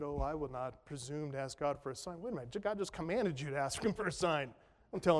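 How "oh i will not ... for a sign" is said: mock-humble, soft and low; faux humility. Pastor mocking Ahaz's refusal, in faux-humble voice. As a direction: put on a falsely modest voice. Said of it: "in this kind of faux humility".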